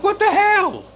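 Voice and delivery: high exasperated what the hell; disbelief. Retold or imitated. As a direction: throw the line up high in disbelief. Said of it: "I'm like".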